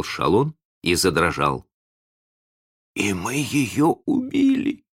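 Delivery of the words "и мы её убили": higher, slow, lightly hoarse voice; mildly hoarse character voice. A narrator's reading. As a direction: slowly voice a dawning horror, hoarsely. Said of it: "сказал Задавака хрипло".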